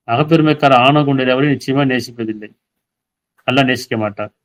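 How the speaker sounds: normal talking voice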